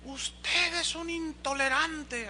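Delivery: high, slow mock accusation; accusing woman. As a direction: mimic the angry church lady. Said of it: "me increpó y me dijo". (imitando a la señora acusadora, aguda y lenta)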